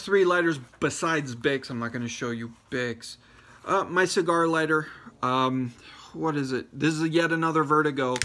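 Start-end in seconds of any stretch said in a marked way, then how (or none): none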